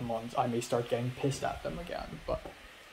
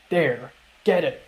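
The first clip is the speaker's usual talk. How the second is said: a mock curt snap, a bit louder; mean curt reply; 7 dB louder than usual. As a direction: brush her off, imitating a mean classmate. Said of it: "but she's so mean".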